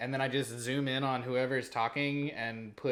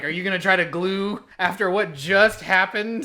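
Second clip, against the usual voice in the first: louder, higher mock scolding, in Cockney-free plain English; retelling a scolding of a friend about to glue, louder and higher; exasperated retort; 6 semitones higher, 10 dB louder than usual. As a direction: call out a friend in playful disbelief. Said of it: "I was like".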